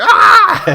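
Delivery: loud, very high comic shriek; imitated scream. Podcaster imitating a skinned zombie victim, loud high scream. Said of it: "a screaming skull".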